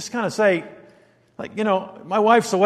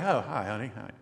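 low, very soft murmur to a spouse; gentle half-asleep greeting; 11 semitones lower, 13 dB quieter than usual. Acted. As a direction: greet your wife quietly in the dark. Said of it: "It's just, oh, kinda like".

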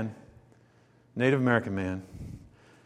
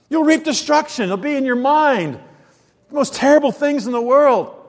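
higher and louder, driving the point home; full-force preaching; 6 semitones higher, 6 dB louder than usual. Acted. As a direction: preach it hard to the congregation.

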